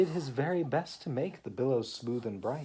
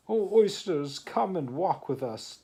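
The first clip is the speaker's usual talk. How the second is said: calling out; 8 semitones higher, 9 dB louder than usual. Acted. Walrus calls to the oysters.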